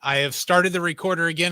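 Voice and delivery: usual speaking voice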